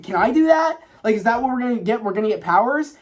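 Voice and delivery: high, eager imitation of amazed questioning; excited. Kano reacting to a fireball, retold excitedly. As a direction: pitch leaps up in mock wonder.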